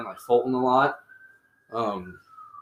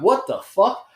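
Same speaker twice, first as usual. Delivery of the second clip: recalled panic, pitched up; retold shock; 8 semitones higher, slower than usual. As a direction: throw up the question in alarm, half-joking. Retold shocked reaction to police.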